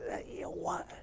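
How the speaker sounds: unvoiced whisper, secretive; conspiratorial whisper. Priest confiding a plan to his student, whispered.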